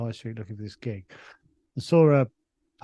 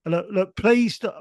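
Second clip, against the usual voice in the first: high, imitated plea to a crowd; pleading; 7 semitones higher, 6 dB louder, slower than usual. MC facing mayhem from the crowd, high pleading. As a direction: plead helplessly, pitched up.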